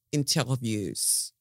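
slow, drawn-out fake French accent. Shown as a demonstration. Podcaster playfully showing off to listeners, mock French accent. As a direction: say "interviews" as if it were French. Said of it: "as they like to say in France".

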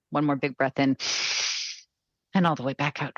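guided breathing. Acted. Closing the breathing exercise.

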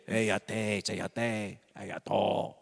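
Star Wars alien. Retold or imitated. Imitating a Return of the Jedi character, alien voice. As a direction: imitate an alien tongue, low. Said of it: "That's from Star Wars".

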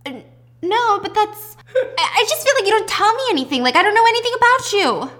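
high, loud, stammering protest; flustered mother. Retold or imitated. Acted flustered mother arguing with daughter.

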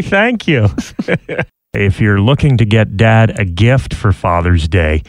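ordinary talk

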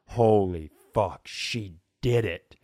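normal talking voice

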